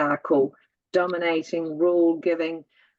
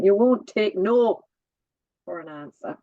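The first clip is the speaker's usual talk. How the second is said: put-on Welsh accent. Daughter redoing mum's telling-off in a Welsh accent. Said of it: "say it in the Welsh accent".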